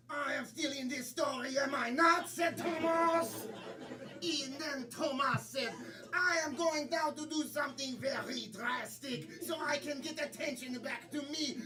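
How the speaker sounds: put-on French accent; mock French storyteller. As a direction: protest with a stagey French accent.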